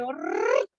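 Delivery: low rolling orrr sound; scrolling whoosh. Retold or imitated. Coach imitates chats scrolling away, low rolling whoosh. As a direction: roll the r like messages flying up.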